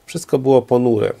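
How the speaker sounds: plain reading voice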